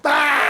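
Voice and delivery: high, loud shocked cry; shocked scream. Acted. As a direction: gasp out in alarm. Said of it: "And the mom went".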